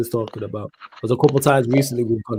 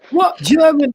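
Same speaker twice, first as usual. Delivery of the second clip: high, loud mock disbelief; losing it, imitated; 5 dB louder, slower than usual. Retelling a streamer's reaction, mock disbelief. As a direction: mimic a fan exploding, voice jumping up. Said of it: "he lost it".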